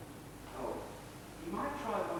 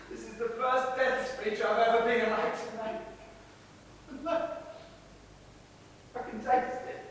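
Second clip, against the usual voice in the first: high, halting phrases; dying speech; 5 semitones higher than usual. Acted. Actor making a first death speech, halting. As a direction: break it up with long pauses, as if wounded.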